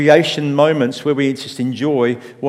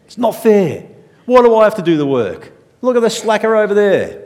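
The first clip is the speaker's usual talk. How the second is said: higher, louder sulky whine, slowing on fair; whiny older sibling; 7 semitones higher, 4 dB louder than usual. Retold or imitated. Older sibling whining about the dishwasher, sulky and loud. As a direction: complain like a hard-done-by kid. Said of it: "We've heard that in our homes".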